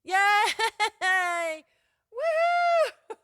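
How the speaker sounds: very high, loud cheer of relief; the host whoops when the stream finally works; real excited whoop. Real, not acted. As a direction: let out a big cheer. Audio finally works, celebrating.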